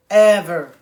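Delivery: Ever said loud and firm; emphatic loud. Real, not acted. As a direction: punch Ever out, loud and final. Repeating never talking about trauma.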